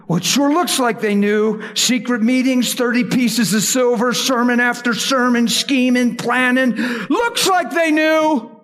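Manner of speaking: preaching at full force, rising; building to a shout. Real, not acted. Preacher to congregation, rising to a shout.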